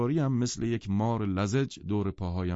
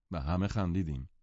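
plain reading voice

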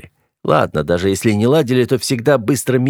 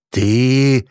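stunned, choked-off single word; gasped out in shock; breath caught; 4 semitones higher than usual. A narrator's reading. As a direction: catch your breath on the word. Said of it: "дыхание перехватило".

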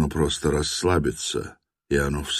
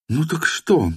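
«Ну так что?» in her higher voice; woman's voice; 11 semitones higher than usual. A narrator's reading. («Ну так что?» женским голосом)

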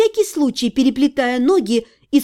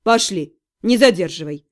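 low, curt demon command; impatient demon; 7 semitones lower than usual. A narrator's reading. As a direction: order it in a much lower voice. Demon orders heroine to move.

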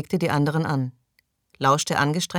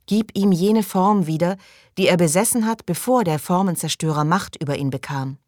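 higher, louder invocation; prayer leader; 4 semitones higher, 3 dB louder than usual. Acted. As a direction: lead the prayer with raised voice. Leader recites prayer, others repeat. (mit erhobener Stimme vorbetend)

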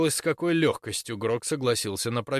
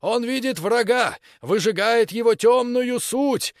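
fanatical preaching; 9 semitones higher, 5 dB louder than usual. Acted. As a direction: rouse the crowd like a fanatic. Preacher continues shouting to crowd.

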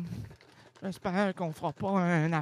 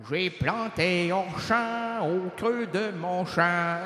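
slow solemn; much slower than usual. Pretend. Solemn wordplay delivery.

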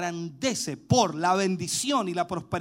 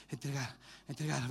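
quiet; 7 semitones lower, 10 dB quieter than usual. Acted. Shows eager giving, drops voice.

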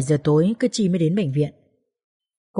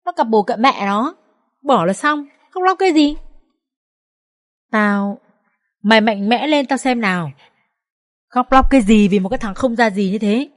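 higher-pitched, exasperated telling-off; indignant, impatient rebuke; angry scolding; 4 semitones higher than usual. A narrator's reading. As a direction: scold a friend to toughen her up. Friend scolds crying woman.

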